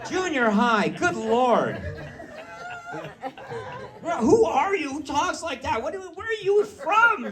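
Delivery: high, loud mock-indignant exclaiming; shocked outburst. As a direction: squawk in disbelief at a child's swearing. Shocked father reacting to daughter.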